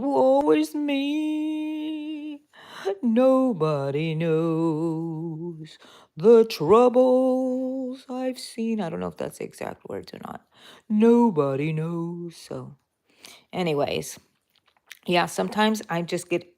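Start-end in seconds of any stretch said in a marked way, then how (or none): 0.0-8.8 slow, drawn-out woeful moan, much higher; mock lament. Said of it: "I'm gonna complain". 10.9-12.4 slow, drawn-out woeful moan; milk the self-pity; mock lament. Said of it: "I'm gonna complain".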